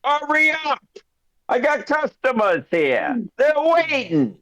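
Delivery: high voice shouting to the back room; shopkeeper calling out. Acted. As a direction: hurry up the assistant. Shopkeeper yells for assistant.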